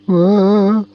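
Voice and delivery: high, loud scared shriek; frightened shriek. A narrator's reading. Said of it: "shrieked Bobby Bus".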